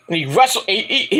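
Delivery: usual speaking voice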